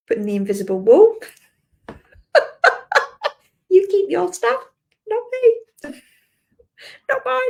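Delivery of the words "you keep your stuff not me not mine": much higher, playful voice pushing back; mock squeaky refusal. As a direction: squeak it out like a cheeky child refusing. Putting up an invisible wall.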